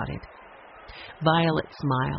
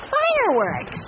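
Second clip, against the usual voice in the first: excited cry; slower than usual. A narrator's reading. Child excited by fireworks.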